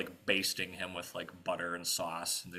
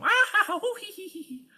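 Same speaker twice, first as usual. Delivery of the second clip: very high giggling witches; whoop, then titter away in falsetto; raucous witch laughter; 7 dB louder than usual. Acted. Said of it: "but raucous laughter".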